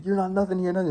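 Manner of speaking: imitating a drill sergeant; drill sergeant yelling. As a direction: bark it at the recruit. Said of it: "he's getting yelled at".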